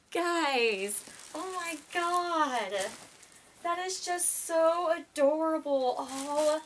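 excited fangirl gushing. Real, not acted. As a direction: squeal over the adorable lace. Gushing at star lace.

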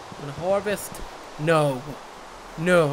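ordinary talk

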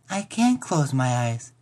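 put-on slow, earnest little girl; dead-serious child. A narrator's reading. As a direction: say it slowly, in deadly earnest. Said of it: "dead serious".

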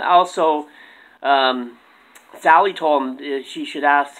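ordinary delivery

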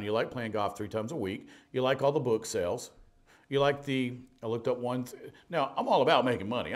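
ordinary delivery

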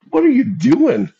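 very high, slow, disbelieving; can't believe what he's seeing; incredulous question. Retold or imitated. A coach asking a stranger why he swore at kids, very high and disbelieving.

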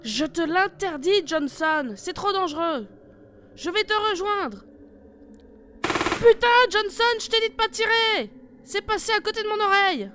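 loud, high, furious orders; angry shout. Acted. Leader forbidding partner to shoot, furious shouting. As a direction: forbid it, then explode with anger.

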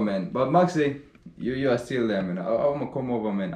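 ordinary talk, nothing marked

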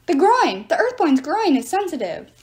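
excited yelled warning; calling out advice. A narrator's reading. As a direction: shout tips from above.

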